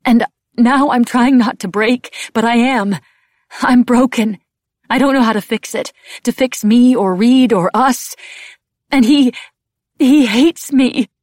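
loud, high, anguished breakdown; distraught, halting, close to sobbing; choked up. A narrator's reading. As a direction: cry it out, choking on the words. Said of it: "I choked on the words".

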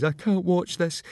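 high, put-on voice of a squeamish friend; high-pitched dismay; dismayed onlooker. A narrator's reading. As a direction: say it high, unable to bear watching. Squeamish friend watching a beating.

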